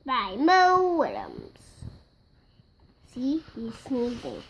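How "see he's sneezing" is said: whispered aside. Acted. Whispered aside about sneezing.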